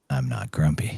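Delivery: a deep grumpy technician; grumpy tech. Retold or imitated. The grumpy tech snaps at the gambler, low grumble.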